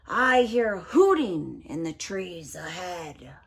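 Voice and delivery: low, slow, loud monster voice; Gruffalo. Acted. Gruffalo hears hooting in trees, low and slow. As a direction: lumber through the words in a low voice.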